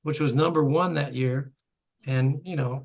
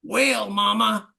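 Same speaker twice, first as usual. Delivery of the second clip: mock yell, slow and raised in pitch; imitated yell; 6 semitones higher, much slower than usual. Imitating a bandmate's shout before the solo, mock yell. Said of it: "you yell out".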